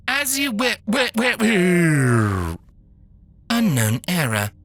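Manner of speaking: stuttering robot, stuck on a syllable; a malfunctioning machine repeating itself; glitching robot. Acted.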